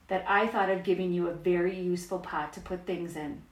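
usual speaking voice

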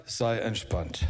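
soothing words against the storm. Acted. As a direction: settle them down gently. Third calming, ship sinking.

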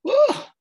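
high, loud "wuh"; impressed whoop. Acted. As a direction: exclaim in amazement, pitch leaping up. Marvelling at door-to-door witnesses' persistence.

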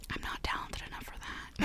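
whispered self-deprecating aside; whispering. Real, not acted. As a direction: drop to a whisper.

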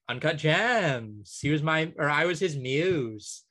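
slow, drawling celebrity impression with stretched vowels; mock her, drawing out "Gems" and "muse"; Julia Fox. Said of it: "Julia Fox saying".

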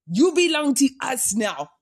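hint of a bad Russian accent; half-hearted mock Russian accent; failed Russian accent. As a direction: attempt a Russian accent and miss. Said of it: "I can't do a Russian accent".

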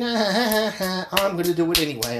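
high, fast, sneering fake laugh into the words; mocking gloating politician. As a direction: cackle at the listener like a smug politician getting away with it. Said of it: "and saying".

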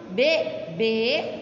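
"bebê" as two separate syllables, be, bê; syllable split. Shown as a demonstration. Teacher checking oxytones with class, "bebê" broken in two.

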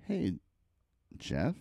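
soft, low and halting; hushed, uneasy hello; awkward coworker greeting. Retold or imitated. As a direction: imitate hesitant coworkers, with long pauses. Coworkers awkwardly greet fired man.

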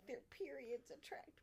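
unvoiced hushed whisper. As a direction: whisper it like a secret. Quoting line, whispered.

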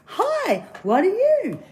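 very high, squealing frog voice; wide-mouthed frog. Acted. Frog greeting the snake, very high voice. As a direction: call it out as a high-pitched frog. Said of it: "the wide-mouthed frog goes".

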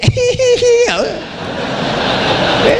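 high mock giggle; high-pitched tittering laugh; giggling imitation. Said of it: "Cekikikan dia".

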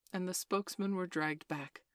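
ordinary delivery, nothing marked

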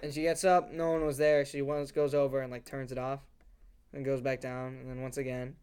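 ordinary delivery

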